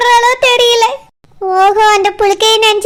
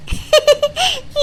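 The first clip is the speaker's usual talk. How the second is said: short laugh; 5 semitones higher, 5 dB quieter than usual. Acted. Amused at the phone joke.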